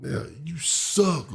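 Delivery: a lightly voiced old lady's jeer; old lady heckling. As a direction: croak it like a cranky old fan. Old lady booing wrestler.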